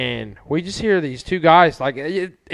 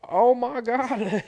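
higher, slow, drawn-out disbelief; stunned oh my god; 10 semitones higher, much slower than usual. Retold or imitated. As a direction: let it hang in slow disbelief. Retold shocked reaction.

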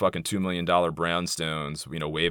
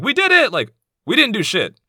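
much higher, louder gloating cheer; mock celebration; 9 dB louder than usual. Mocking rich liberals cheering the election, shrill mock whoop. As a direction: parody a smug victory whoop.

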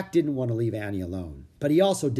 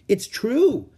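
put-on high, louder girl's voice; Annie insisting; 11 semitones higher, 7 dB louder than usual. A narrator's reading. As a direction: press the point, high. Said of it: "said Annie".

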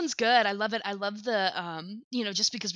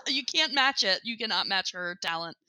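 normal talking voice

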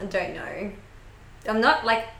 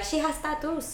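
mum's pitched-up shocked gasp of a line; hint of a scandalised mother; mock shocked mum; 8 semitones higher, 3 dB quieter than usual. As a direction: play mum horrified at the tattoos. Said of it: "Mum would be like".

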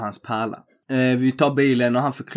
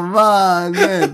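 higher and louder through laughter; laughing mannen; 6 semitones higher, 6 dB louder than usual. Retold or imitated. Answering the cop while laughing, higher and louder. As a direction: laugh it out at him.

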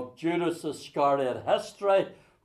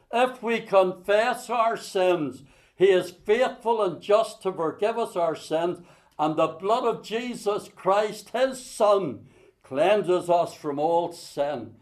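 scripture reading; 3 semitones higher than usual. Acted. Reading First John aloud.

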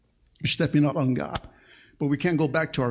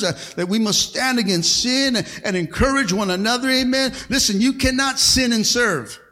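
loud, driving preacher cadence; fiery preaching; 6 semitones higher, 6 dB louder than usual. Acted. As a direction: preach it out to the whole church. Preaching at full force.

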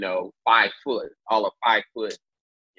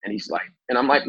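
usual speaking voice

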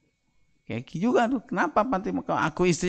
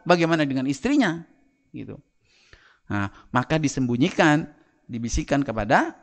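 ordinary talk, nothing marked

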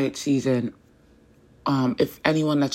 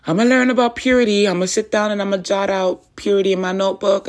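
high, raised-voice pledge; eager resolve; 6 semitones higher, 5 dB louder than usual. Retold or imitated. Woman answering God's call to learn purity, emphatic resolve. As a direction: launch into an emphatic promise.

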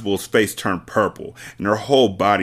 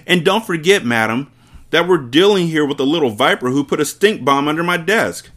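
louder, higher warning; threatening headmistress; 7 semitones higher, 4 dB louder than usual. Acted. Headmistress warning the teacher, loud. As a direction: hammer the warning home.

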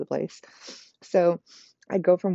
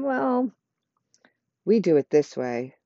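negative-sounding. Shown as a demonstration. Telling a new client how it is done, negative. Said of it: "with a negative sound in your voice".

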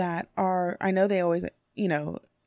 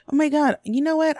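excited realization; 9 semitones higher, 6 dB louder than usual. Retold or imitated. Acted realization in a quote.

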